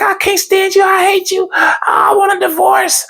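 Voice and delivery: high whiny wife voice; high-pitched mock-angry wife; wife talking smack. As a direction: whine the threats up high. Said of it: "She's like".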